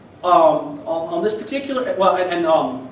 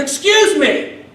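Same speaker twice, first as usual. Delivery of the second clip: high, loud, slow nosy lady call with a laugh; 9 semitones higher, 8 dB louder, slower than usual. Retold or imitated. Nosy woman in the parking lot calling out to the blind student: loud, high, scandalised. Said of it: "She calls out to me".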